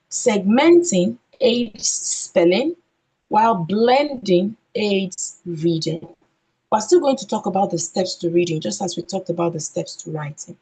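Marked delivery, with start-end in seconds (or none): 0.1-6.1 very slow, louder, pressing every word; slow emphatic. Shown as a demonstration. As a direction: hammer the rule home for the class. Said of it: "Do you get the difference?".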